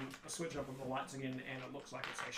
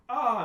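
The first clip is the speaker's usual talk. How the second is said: loud, higher-pitched mock startled "ah"; startled cry; 10 semitones higher, 13 dB louder than usual. Game master voices startled performers jumping back, startled cry. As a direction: act out a crowd jumping back.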